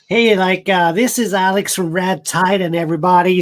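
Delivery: hammed-up American YouTuber intro; bad geeky American accent. Shown as a demonstration. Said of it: "like, bad American accent".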